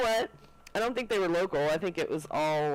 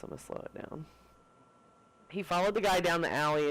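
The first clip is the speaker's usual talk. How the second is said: ordinary talk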